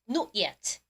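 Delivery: British "Not yet", rounded o in Not; British pronunciation. Shown as a demonstration. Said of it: "영국 발음 뭐라고요".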